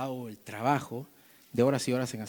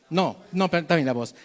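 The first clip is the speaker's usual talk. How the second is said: ordinary talk, nothing marked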